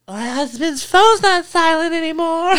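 put-on high, slow drunk voice; imitating the drunk friend's remark. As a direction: say it like a tipsy friend. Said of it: "hilarious when she's drunk".

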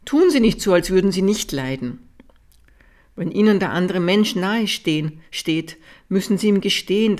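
usual speaking voice

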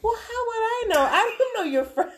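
high, squealing laughing protest; exasperated reply. Retold or imitated. Mom protesting daughter's question about a friend, high exasperation.

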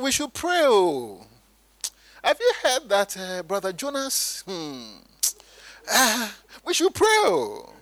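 high-pitched, put-on pious concern; sanctimonious lament, sinking on each sigh; spiritualized gossip. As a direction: gossip as if calling to prayer. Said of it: "When I spiritualize gossiping".

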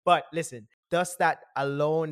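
usual speaking voice

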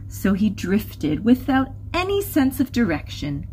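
lilting rhyming storyteller with lively swings; story narration. Acted. Narrator tells listeners of Humphrey's wanderings, lively storytelling.

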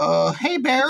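hammed-up wimp's feeble hello; wimpy. Telling friends how they greeted the bear, wimpy. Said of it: "the most wimpy way you could possibly say".

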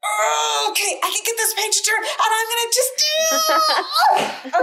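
high, loud, giddy shout with a laugh; hammy excitement. Acted. Theater nerd retelling a book, giddy high shout. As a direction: ham it up like a kid winning. Hammy child reading, triumphant.

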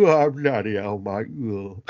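low hammed-up Cockney moan; mock Cockney groan. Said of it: "fall on the floor and go".

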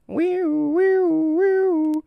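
high siren wail, rising each time; mock alarm siren. Scene: teen and his buddy react to an angry kid with a mock siren wail. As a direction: whoop like a siren going off.

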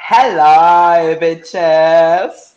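big, loud, brassy hello; loud diva greeting. Real, not acted. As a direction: burst in announcing yourself. Guest bursts in greeting.

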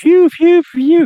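high, loud "phew phew phew"; mock shooting noises. Reacting to a furious thesis story, playful shooting noises. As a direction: fire off playful high-pitched shots with the voice. Imitates shots at the angry story.

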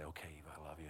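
low, very soft fatherly murmur; tender bedtime voice. Retold or imitated. Dad saying good night to his daughter, soft and tender. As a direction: keep it hushed, for a child in bed.

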